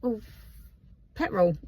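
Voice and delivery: put-on plain Essex-accented "petrol"; own Essex accent. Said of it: "pick up on my accent".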